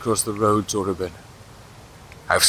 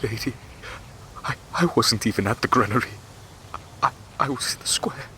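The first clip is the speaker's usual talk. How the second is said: much softer, stammering, begging for his life; frightened pleading; 2 semitones higher, 7 dB quieter than usual. Acted. Prisoner begs the widow to spare him, soft and afraid. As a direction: plead with her quietly, terrified.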